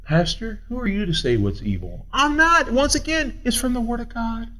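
ordinary delivery

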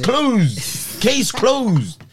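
high, insistent declaration; emphatic verdict. Real, not acted. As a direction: hammer home the ruling in separate short bursts. Lays down the verdict in argument.

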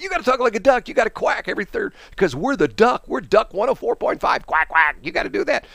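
high, excitable pushy boss; insistent station boss. Retold or imitated. Boss insists we're the duck, high and pushy. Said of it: "the boss".

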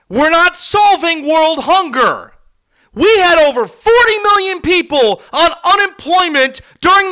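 real outraged shouting about player salaries; furious rant. Real, not acted. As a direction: let the anger explode. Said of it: "I'm getting way too mad right now".

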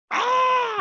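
very high imitated scream; sudden high-pitched pretend scream; mock scream. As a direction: shriek like the victim on the tape. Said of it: "a lady screaming".

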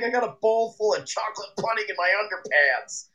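high, squealing movie-quote voice; mock Farley voice. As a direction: screech it like Farley in a panic. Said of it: "he's like".

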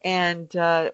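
normal talking voice

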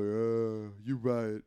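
slurred action-star impression; lightly mocked sluggish hero voice; Stallone. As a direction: dim tough guy slowly coming round. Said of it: "And Stallone's like".

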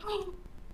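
sudden high delighted gasp; sharp excited gasp. Retold or imitated. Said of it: "she's like".

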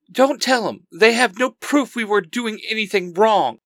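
high-pitched villainous warning; evil hiss. A narrator's reading. Helena warns her captured partner, evil and high-pitched. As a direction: warn an accomplice, mildly villainous and high. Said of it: "says with a evil hiss".

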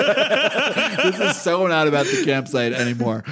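laughing while talking; slow, laughing disbelief. Real, not acted. Laughing reply.

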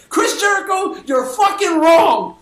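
slow, loud shout of the verdict; voicing the crowd's shouted verdict. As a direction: hammer it home like a chant. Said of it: "came together and said".

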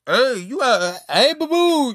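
high, loud singsong "boo-boo"; high mocking sing-song at a friend; teasing call.

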